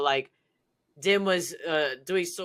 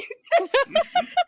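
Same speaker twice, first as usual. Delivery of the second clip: a high, nervous burst of laughter; 3 dB louder than usual. Real, not acted. Laughing nervously while telling the judging story.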